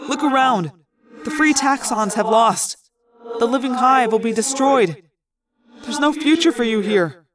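high urgent plea; urgent pleading. A narrator's reading. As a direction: urge him hard to come. Urging his friend to come.

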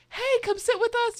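high-pitched mimicked friendly call; calling invitation. Friend calling to her in the cafeteria, high mimicry. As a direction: pitch it up to invite someone over.

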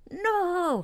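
high, protesting no; mock-outraged Scottish refusal; indignant Scotsman. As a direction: imitate an appalled Scot refusing. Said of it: "he's a Scotsman, it was like".

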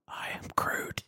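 Groot line in a soft whisper. Acted. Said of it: "like, a Groot accent".

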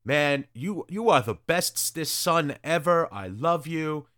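hammed-up doting father; gushing father. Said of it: "Ambrose is standing there going".

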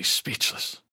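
ordinary delivery, nothing marked

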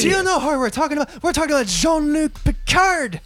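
high, loud, fast hype; excited. Real, not acted. Co-host hypes the favorite scene to friends, excited and loud. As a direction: announce it like a thrilled fan.

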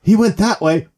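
high, loud cry for help; mock-frantic pointing out a thief; imitated frantic victim. Robbed woman to mom in minivan, frantic shout.

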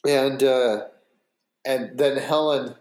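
ordinary delivery

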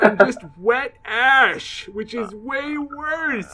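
silly character voice. Acted. Continues Mud persona.

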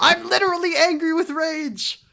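high, loud furious ranting; hammed-up fury; mock seething rage. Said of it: "Dojima fucking seething with rage".

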